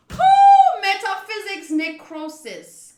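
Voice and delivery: hyped-up outburst; excited exclamation. Real, not acted. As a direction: blurt it out then savour the jargon. Hammed reading of game jargon.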